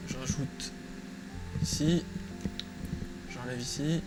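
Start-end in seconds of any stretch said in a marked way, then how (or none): none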